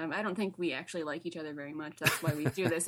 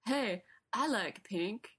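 imitating Wiseau's accent; 7 semitones higher, 4 dB louder, slower than usual. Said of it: "I can't do the accent very well".

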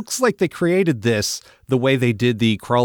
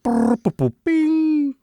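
much higher, slow beeping robot noises; robot malfunction noises; much slower than usual. Retold or imitated. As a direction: go boop bing like a glitching cartoon robot. Imitating Jetsons robot malfunction.

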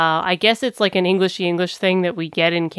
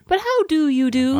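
high, hammed-up English politeness; posh British greeting; 9 semitones higher than usual. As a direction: insist politely, pitched up. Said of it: "it's like saying".

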